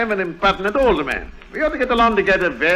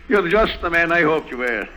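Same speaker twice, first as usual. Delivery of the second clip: usual speaking voice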